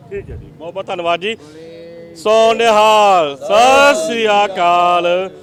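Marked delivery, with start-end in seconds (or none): 2.1-5.3 very slow, loud call of the Sikh slogan; drawn-out jaikara. Real, not acted. Calling the jaikara to the gathered crowd, long and loud. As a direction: stretch each word out like a rallying cry.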